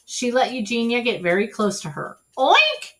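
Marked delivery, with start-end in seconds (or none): 2.4-2.9 high, loud squealing oink; playful oink. Acted. Mercy oinking at Eugenia as she dashes away. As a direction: taunt like a pig. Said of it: "said Mercy".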